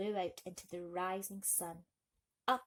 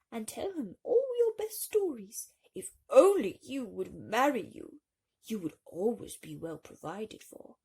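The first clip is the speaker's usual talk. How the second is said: coaxing field mouse; 8 dB louder than usual. A narrator's reading. Field mouse urges marriage.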